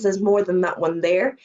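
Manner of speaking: usual speaking voice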